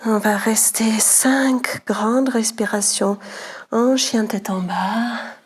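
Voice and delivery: slow, calm guiding voice; slow yoga cueing. Acted. Yoga teacher closing the last Namaskar cycle, slow calm cueing. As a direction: draw the class through the last cycle slowly.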